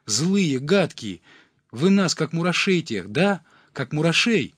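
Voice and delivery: high, loud, outraged accusations; boy's angry shout. A narrator's reading. Колька accuses the tourists of cruelty, angry shouting. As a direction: cry out against the grown-ups. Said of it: "кричал Колька".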